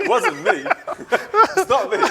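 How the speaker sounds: high, laughing protest; laughing denial. Real, not acted. Denying he has the accent, laughing high. Jokingly denies having the accent.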